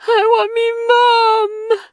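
put-on small child wailing for her mother. Scared tyke wailing for her mom at night, tearful. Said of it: "the tyke wailed".

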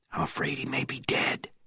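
soft breathy whisper. A narrator's reading. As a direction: whisper the bad news. Hiding near guards, grim news.

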